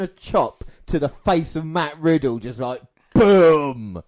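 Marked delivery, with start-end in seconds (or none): 3.1-4.0 long, loud drawn-out boom; explosive, stretched sound effect; booming impact noise. Retold or imitated. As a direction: blast the word out like a huge hit. Imitating a chop landing.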